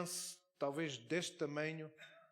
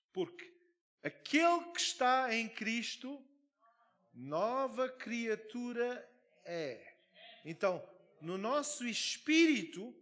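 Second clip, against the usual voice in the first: louder, higher, slow emphatic preaching; forceful preaching; 5 semitones higher, 5 dB louder, slower than usual. Acted. Pastor preaches to the congregation about the oppressed with forceful emphasis. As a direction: hammer each phrase home to the congregation.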